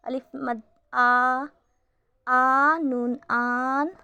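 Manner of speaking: slow, with आ and आन long and stretched; drawn-out madd. Shown as a demonstration. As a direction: pull the vowel आ out long. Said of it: "मद को थोड़ी सी खींच कर पढ़ते हैं".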